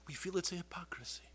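a secret, breathy grievance; hushed whisper. Retold or imitated. Women whispering their complaint about wigs.

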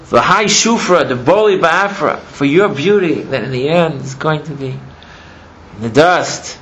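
loud, slow, high-pitched mourning; retold grief, easing down toward the end; impassioned lament. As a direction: stretch out each phrase in loud lament. Acted lament over beauty turning to dust.

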